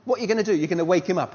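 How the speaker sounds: ordinary talk, nothing marked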